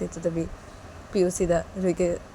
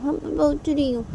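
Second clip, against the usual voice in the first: put-on higher, little girl voice; sleepy child; 9 semitones higher than usual. Daughter replying to father while waking, childlike. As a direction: answer like a drowsy kid.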